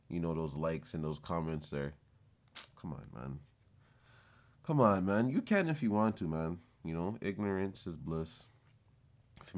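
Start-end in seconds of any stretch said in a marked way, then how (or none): 2.8-3.4 low, soft, under the breath; muttered aside. Acted. Repeats phrase, first muttered. 4.7-6.2 louder, higher, calling it out; emphatic come on. Acted. Repeats phrase, second louder.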